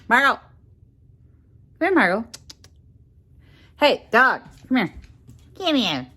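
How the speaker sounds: loud, high calls; loud call for the dog to come. Real, not acted. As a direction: summon the dog insistently. Calling the dog.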